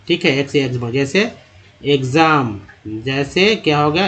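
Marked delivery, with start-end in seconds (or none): 1.8-2.6 "एग्जाम" with x said as gz; gz sound. Shown as a demonstration.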